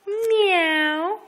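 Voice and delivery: high, loud "Meow" like a cat; loud kitty meow. Pretend. Mother meows high and loud to her toddler. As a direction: mew it high and loud for the child.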